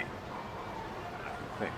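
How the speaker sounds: soft breathy afterthought under the breath; whispered aside. Acted. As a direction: mutter it to yourself. Unsure aside after boast.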